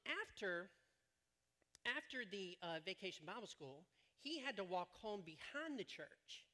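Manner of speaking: ordinary talk, nothing marked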